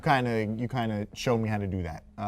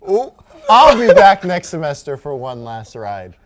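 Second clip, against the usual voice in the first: excited sign-off; 6 semitones higher, 17 dB louder than usual. Real, not acted. Sign-off hype on final show.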